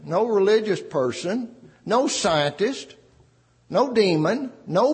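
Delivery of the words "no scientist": usual speaking voice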